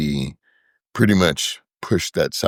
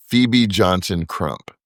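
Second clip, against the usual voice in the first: ordinary delivery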